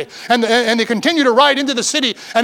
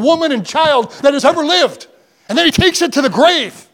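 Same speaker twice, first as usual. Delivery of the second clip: raised, higher preacher's cry, building; preaching at full force; 5 semitones higher than usual. Acted. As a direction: lift the congregation with rising fervour.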